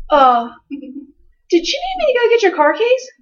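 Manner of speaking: put-on high, slow, spacey kid voice; dazed son. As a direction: sound completely lost, drifting through it. Dazed son answering mom.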